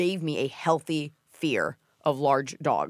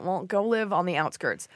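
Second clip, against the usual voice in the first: ordinary delivery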